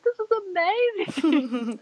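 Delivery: high, slow, tearful joy; overjoyed crying exclamation; ecstatic. Retold or imitated. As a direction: cry it out in happy disbelief. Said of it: "I was like".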